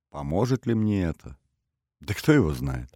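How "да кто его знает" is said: usual speaking voice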